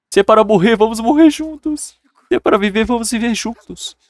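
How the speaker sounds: high-pitched weepy melodramatic plea; mock crying. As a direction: wail theatrically as a pleading wife. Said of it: "ela começou a chorar".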